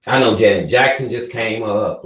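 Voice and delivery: ordinary talk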